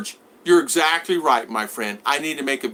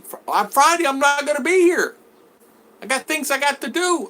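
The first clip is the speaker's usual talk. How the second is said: high-pitched, put-upon objection; exasperated mock complaint; acted indignant protest; 13 semitones higher, 3 dB louder than usual. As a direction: protest loudly and high, retelling the argument. Acted protest to an unannounced visitor.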